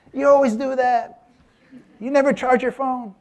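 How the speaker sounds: high-pitched imitated wife nagging; wife scolding him about his dead phone; mock scolding wife. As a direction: needle him the way a fed-up wife would. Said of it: "my wife was livid".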